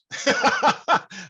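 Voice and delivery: a sudden high burst of laughter; laughing. Real, not acted. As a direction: laugh out at the thin response. Laughs at weak response.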